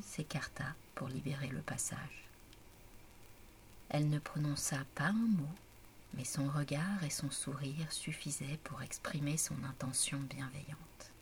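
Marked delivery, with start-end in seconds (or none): none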